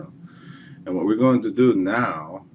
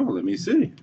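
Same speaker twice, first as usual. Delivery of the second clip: curious ask; 11 semitones higher than usual. Retold or imitated. Narrator asks to see drawing.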